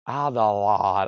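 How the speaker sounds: low, slow, badly faked Australian accent; attempted Australian accent. Shown as a demonstration. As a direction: have a half-hearted go at sounding Australian. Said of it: "You gotta say that with an Australian accent".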